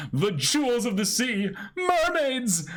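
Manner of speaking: high adoring gush; swooning. Acted. Sanji gushing over mermaids, high swoon. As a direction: gush like a lovestruck sailor.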